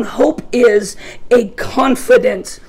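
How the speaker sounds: slow, deliberate hope is a confident expectation; dictating slowly. Shown as a demonstration. Preacher dictating the definition for note-takers, slow. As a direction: say it slowly so they can write it. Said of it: "just write down".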